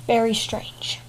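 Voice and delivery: whispered aside; a soft conspiratorial whisper. Real, not acted. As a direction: lean in and whisper it. Whispered aside about the cat.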